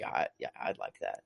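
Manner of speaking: very low, soft retold reply; quiet acceptance. Retelling Christine accepting a date, quiet and low. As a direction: drop right down, almost under the breath.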